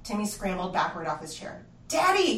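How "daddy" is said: loud, high yell for his dad. Acted. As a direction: scream for Daddy in panic. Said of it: "he yelled".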